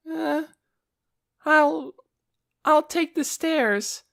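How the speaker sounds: put-on high, slow stammering boy; timid boy voice. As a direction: stammer out an excuse.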